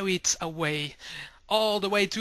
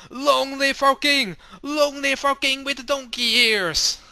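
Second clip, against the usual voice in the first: cheering crowd; 8 semitones higher, 7 dB louder than usual. Acted. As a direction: cheer for the king. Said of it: "all people cry out".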